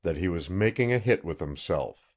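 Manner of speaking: plain reading voice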